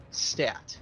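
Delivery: normal talking voice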